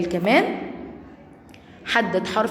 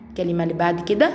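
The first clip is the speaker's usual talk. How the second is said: usual speaking voice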